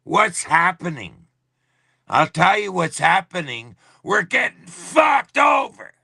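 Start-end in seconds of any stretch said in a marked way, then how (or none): 4.0-5.9 high, loud angry yell; furious shout. Real, not acted. Ranting streamer shouts at his live audience, furious yell. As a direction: blast it at the viewers. Angry livestream rant about Canada.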